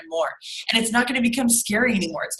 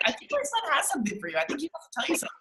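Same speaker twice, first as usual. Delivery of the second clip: high, soft, quick apologetic mom voice; smooth it over sweetly; sheepish apology; 7 semitones higher, 6 dB quieter, much faster than usual. Retold or imitated. Mom apologizing to a stranger for her screaming son, high and soft. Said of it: "And I'm like".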